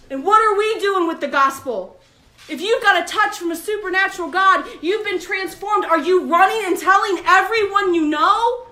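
high, loud preaching build; fiery preaching. Acted. Preacher challenging the church, rising fire. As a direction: drive the challenge home to the congregation.

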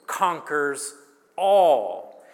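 usual speaking voice

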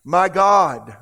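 loud cry to God; Jesus. Retold or imitated. Preacher translating Jesus' cry from the cross, loud cry. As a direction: call out forcefully, voice well up. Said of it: "with a loud voice".

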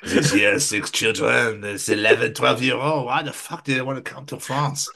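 put-on comic French accent, put-upon grumbling. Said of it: "the French people going like".

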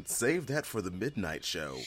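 ordinary talk, nothing marked

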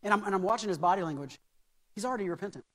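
ordinary talk

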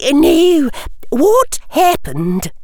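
high, slow, deliberate question; professor prompting. Acted. Professor prompting a tearful pupil to explain, high and deliberate.

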